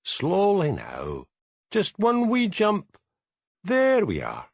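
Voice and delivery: coaxing, slow and high, as to a wary animal; soothing a big cat into a cage, cooing high. A narrator's reading. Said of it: "cooed Hamish".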